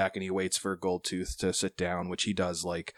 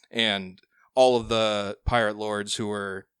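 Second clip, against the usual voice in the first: normal talking voice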